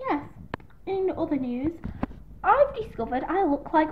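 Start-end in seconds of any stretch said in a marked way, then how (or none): none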